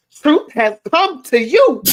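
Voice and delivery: loud, drawn-out mock declaration; mock solemn pronouncement. Teasing the co-host with their own words, mock solemn pronouncement. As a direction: proclaim it slowly and loudly, imitating someone.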